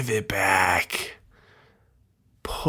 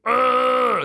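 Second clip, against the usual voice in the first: much higher, louder throaty yelp; upper throat register cry; 4 dB louder than usual. Shown as a demonstration. Showing the weird upper register of a bedtime whimper, high throaty cry. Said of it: "you go into that upper weird register where you use your throat".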